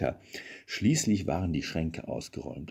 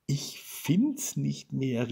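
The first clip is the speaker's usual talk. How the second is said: higher, slow, drawn-out complaint; despairing call; 7 semitones higher, much slower than usual. A narrator's reading. Wife gives up searching for the present, mild despairing call. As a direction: draw it out high, giving up. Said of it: "rief sie endlich".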